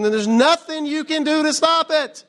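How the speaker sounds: mock-scold the alarmists, stop soaring high; high, exasperated nothing you can do to stop it; exasperated punchline. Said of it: "I'm like".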